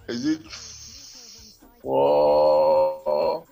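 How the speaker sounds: normal talking voice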